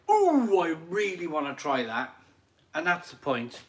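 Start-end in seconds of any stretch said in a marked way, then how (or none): 0.1-2.1 high excited ooh, then settling into I really wanna try that; eager customer. Retold or imitated. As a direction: squeal the ooh like a thrilled drinker. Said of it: "where people might go".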